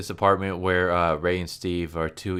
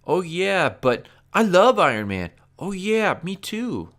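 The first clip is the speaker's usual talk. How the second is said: much higher, airheaded mock gush; a high mock-fangirl voice; mocking millennial fans; 11 semitones higher, 6 dB louder than usual. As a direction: parody two gushing kids, pitched way up. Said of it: "millennial speak".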